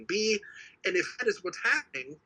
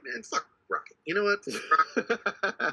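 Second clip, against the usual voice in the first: mock dismissive rant; 5 semitones higher than usual. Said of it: "be like".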